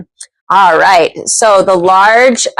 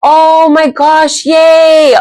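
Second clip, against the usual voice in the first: excited cheer; 8 semitones higher, much slower than usual. Real, not acted. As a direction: cheer at the prize. Prize cheer.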